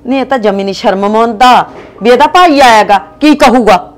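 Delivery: loud, indignant scolding; angry shout. Acted. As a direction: keep yelling at him, outraged. Wife keeps arguing with husband.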